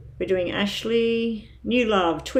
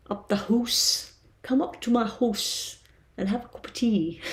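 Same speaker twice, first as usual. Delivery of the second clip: Scottish accent; 4 semitones higher, 3 dB quieter than usual. Shown as a demonstration. Tarot streamer showing viewers her old Scottish accent. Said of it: "I used to like doing the Scottish accent".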